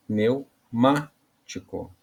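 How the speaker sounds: "Pneumático" slowly, MÁ punched and set apart; stress on MÁ. Shown as a demonstration. Teacher showing how to pronounce it, "Pneumático" slowly with stressed MÁ. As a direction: hit the MÁ in "Pneumático", pausing either side. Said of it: "e na hora de pronunciar".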